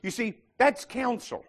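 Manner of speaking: ordinary delivery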